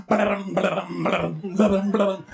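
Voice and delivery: rapid rolling hoofbeat noise; burbled imitation of a horse's gallop; galloping hooves.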